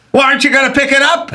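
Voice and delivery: hammed-up radio husband; impatient old-movie man. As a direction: snap like a thirties detective. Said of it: "And he'd go".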